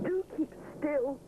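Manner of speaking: high, pleading little bear voice; begging. A narrator's reading. Rupert on Edward's shoulders, lightly begging. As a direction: beg your friend not to wobble. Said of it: "he begs".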